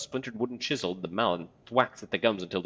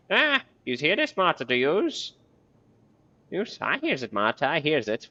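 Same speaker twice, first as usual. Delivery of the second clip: put-on high, loud batty old woman; cackling crone; 7 semitones higher, 4 dB louder than usual. Marta talking to herself in a high batty crone voice. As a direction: chatter to yourself like a mad old woman.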